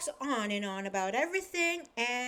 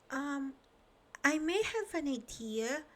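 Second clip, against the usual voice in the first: hint of a timid little voice; small shy voice; 5 semitones higher than usual. Little Miss Shy timidly offering her idea, a touch shy. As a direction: offer it hesitantly. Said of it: "said a very small, shy voice".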